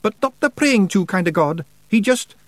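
high Scottish pixie voice; little Scots elder explaining; Scottish Feegle voice. A narrator's reading. Feegle Billy Big Chin speaks.

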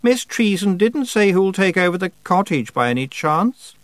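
studiedly casual schemer; feigned casual. Acted. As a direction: pretend it's an afterthought. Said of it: "as if she'd only just thought of the question".

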